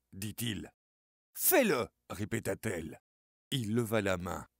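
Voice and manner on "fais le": high, loud repeated order; insistent shout. Acted. Suzanne repeats her demand to Charlie, shouting. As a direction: force him to do it. She insists he make wish.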